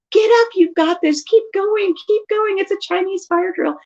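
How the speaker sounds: high-pitched, playful urging; excited rallying call; cheering on. Retold or imitated. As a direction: keep a child's spirits up. Cheers fallen child on, retold.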